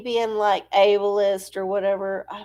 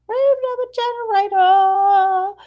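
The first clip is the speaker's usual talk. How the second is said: higher, louder announcer call; 10 semitones higher, 6 dB louder than usual. Pretend. Announcing the segment again higher and louder. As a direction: boom it out again like a host. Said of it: "get to our random number generator".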